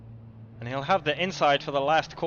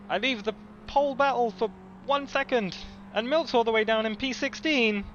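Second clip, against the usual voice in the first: disbelieving; 8 semitones higher than usual. Real, not acted. Disbelief at driver's drop.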